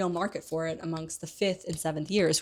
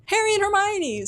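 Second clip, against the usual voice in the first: much higher and louder triumphant call; 6 dB louder than usual. Retold or imitated. Ron on the crowd's shoulders calling to his friends, triumphant. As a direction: shout their names across the crowd.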